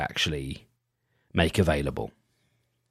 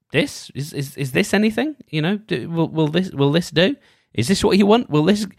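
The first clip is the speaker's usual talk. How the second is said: higher, pleading voice asking if it's enough; anxious asking; 8 semitones higher, 4 dB louder than usual. Retold or imitated. Business owner anxiously asking clients, higher and pleading. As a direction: seek approval, voice rising. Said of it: "another way of me going".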